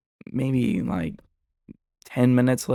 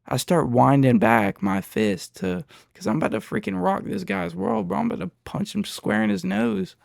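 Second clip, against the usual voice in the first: usual speaking voice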